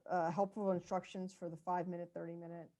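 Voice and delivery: muffled, words hard to make out. Real, not acted. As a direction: speak as if through a covered mouth. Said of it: "you muffled there".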